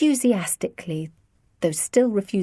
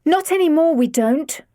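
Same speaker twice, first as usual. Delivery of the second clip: heated outburst; 9 semitones higher, 5 dB louder than usual. A narrator's reading. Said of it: "Lizzie interrupted".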